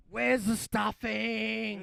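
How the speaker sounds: slow, loud, high chanting yell; mock shout. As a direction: tease him with a bellow. Mocking him to the airport.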